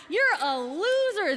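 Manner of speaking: acted scornful put-down; mocking jeer. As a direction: jeer at him like a scornful merchant. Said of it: "they laughed and laughed".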